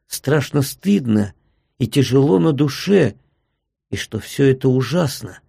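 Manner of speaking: plain reading voice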